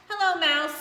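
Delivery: chirpy duck saying hello; cheery duck. Acted. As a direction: call out a bright hello as Duck. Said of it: "said Duck".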